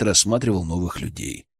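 usual speaking voice

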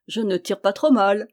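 ordinary delivery

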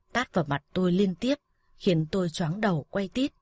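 ordinary delivery

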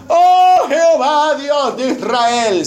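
drawn-out, high theatrical lament; hammed-up crying to God, long vowels; mock wailing prayer. Pastor mimicking Israelites weeping to God, mock wail. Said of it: "se pusieron a llorar, y dijeron".

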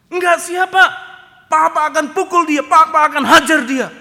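loud, high demand to know who did it; heroic father shout. Retold or imitated. Father bellows at the son to name the bully. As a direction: boom out like a protective dad.

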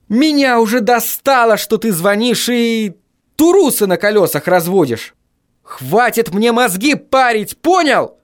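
high exasperated tirade; fed-up rant. A narrator's reading. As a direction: lash out, stretching the words in high pitch. Acted furious rant into phone.

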